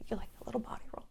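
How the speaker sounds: whispered aside. Real, not acted. Aside while miming a body roll.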